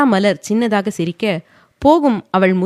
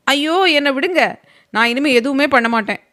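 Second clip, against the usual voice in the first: much higher play-acted cry; hammed-up fake protest; mock yelling; 7 semitones higher, 3 dB louder than usual. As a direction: pretend to be hurt for fun. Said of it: "பொய்யாக அகில் கத்தவும்".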